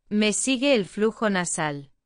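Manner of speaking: normal talking voice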